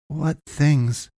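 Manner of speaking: high, wondering short question. A narrator's reading. Boy asking the man about his pursuers, wondering. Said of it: "Kalen wondered".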